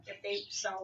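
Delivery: ordinary talk, nothing marked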